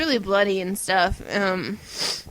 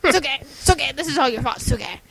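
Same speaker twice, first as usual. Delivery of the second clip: sharp, higher-pitched repeated outbursts; mock vampire boyfriend; 6 semitones higher, 3 dB louder, faster than usual. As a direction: punch out her name like an exasperated vampire lover. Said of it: "And he's like".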